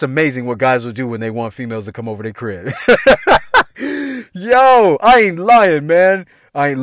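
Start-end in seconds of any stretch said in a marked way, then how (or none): 2.8-4.3 high hearty laugh; long, high-pitched cackle; real laugh. Real, not acted. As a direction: crack up at your own story. Laughing at his own story.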